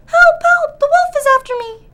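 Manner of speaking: very high, loud panicked squeal; crying for help. Acted. Frightened pig begs his brother to let him in, panicked squeal. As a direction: scream for help while running. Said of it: "all out of breath, crying".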